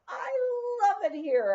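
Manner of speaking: loud, high and thrilled; joyful shout. Retold or imitated. Woman alone in the moonlight shouting her joy. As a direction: shout it out to the moon. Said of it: "I shouted".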